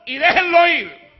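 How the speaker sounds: commanding order. Acted. Christ ordering the crowd to untie Lazarus, loud command. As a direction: give the order to untie him. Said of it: "él dio una orden".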